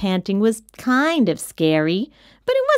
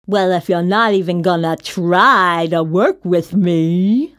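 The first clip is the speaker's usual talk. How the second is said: lower, slobbery monster whine; whining; 4 semitones lower, 4 dB louder than usual. A narrator's reading. Rejected monster to the giggling kid, whining. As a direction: whine it, dragging the last words. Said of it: "Mac whined".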